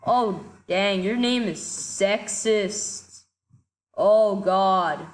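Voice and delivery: normal talking voice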